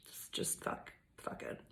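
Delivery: hushed frustrated swear; whispered aside. Real, not acted. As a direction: whisper it under your breath. Frustrated aside under breath.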